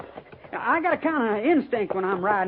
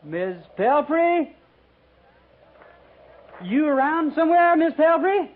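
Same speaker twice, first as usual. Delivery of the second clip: high, louder call, the first name drawn out slow; 6 semitones higher, 3 dB louder than usual. Acted. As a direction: hail her from outside the cabin. Calling out toward cabin.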